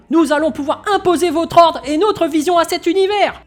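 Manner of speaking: loud, high rousing address to the troops; rallying speech. Acted. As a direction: whip up the soldiers. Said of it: "Et Nagai qui faisait un discours".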